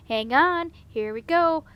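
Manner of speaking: rally the others as you leap; loud rallying call. A narrator's reading. Leaping into action with buckets.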